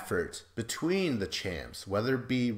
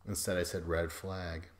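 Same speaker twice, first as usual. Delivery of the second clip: ordinary delivery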